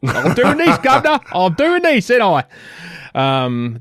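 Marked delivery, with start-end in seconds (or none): 0.1-2.4 high, loud hammed-up Cockney; jokey Cockney put on for the podcast hosts; Cockney accent. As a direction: play the cheeky Cockney geezer, much higher and louder. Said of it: "why are you in a Cockney accent?".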